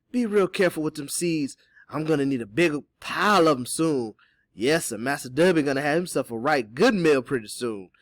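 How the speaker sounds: knowing character voice with heavy emphasis; scheming Teeny. Acted. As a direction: plot it out with relish. Voices Teeny plotting about a stew.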